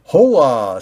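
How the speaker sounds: loud, grand greeting; hearty hola. Acted. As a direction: greet like a big gatekeeper.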